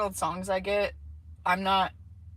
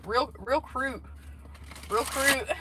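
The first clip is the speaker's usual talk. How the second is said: mimicked Southern accent; 5 semitones higher, slower than usual. Fan imitating a rapper's super cute accent, higher Southern twang. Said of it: "mimic that accent".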